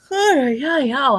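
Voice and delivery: words stretched through a big yawn. Real, not acted. To her live viewers, yawning through the words. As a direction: yawn out the words, high and loud. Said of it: "I don't know why I'm yawning so much".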